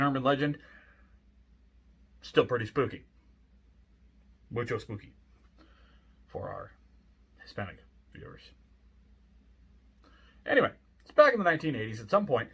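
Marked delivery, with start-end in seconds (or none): 4.5-8.5 fading to a whisper; hushed joking aside. Real, not acted.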